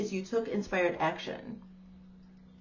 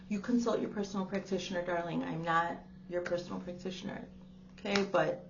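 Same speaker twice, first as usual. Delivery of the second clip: normal talking voice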